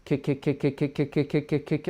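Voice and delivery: quiet repeated kick, unfinished; quiet K tongue drill. Shown as a demonstration. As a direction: start each kick and cut it. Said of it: "just start saying K".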